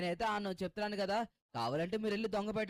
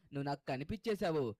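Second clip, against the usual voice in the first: ordinary talk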